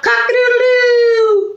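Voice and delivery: high stretched-out rooster call; rooster crow. Pretend. Rooster recap.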